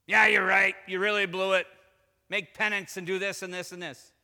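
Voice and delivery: loud mock agreement with the son; loud, forceful telling-off; imagined scolding father. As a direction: come down hard, acting a reproachful father. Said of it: "What does the father say?".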